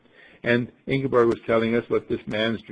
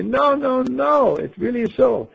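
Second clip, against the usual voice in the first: high, louder insisting no; insistent protest; 12 semitones higher, 4 dB louder than usual. Retold or imitated. [name] insisting to the gang she met a talking bird, high protest. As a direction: plead that it really happened. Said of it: "And I'll say".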